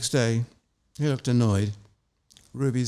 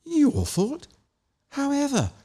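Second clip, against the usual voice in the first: incredulous; 12 semitones higher, slower than usual. Acted. Margot shocked by confession.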